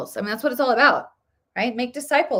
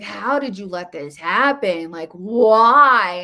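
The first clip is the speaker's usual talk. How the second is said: a mild, pleading cry of why, pushed louder at the end; anguished cry to God; 6 dB louder, slower than usual. Retold or imitated. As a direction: plead with God without going full force. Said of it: "like, crying out to God".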